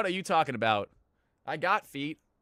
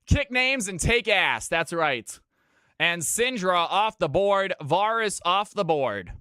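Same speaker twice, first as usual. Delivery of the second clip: caster voice; 4 semitones higher, 6 dB louder, slower than usual. Acted.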